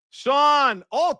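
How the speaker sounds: normal talking voice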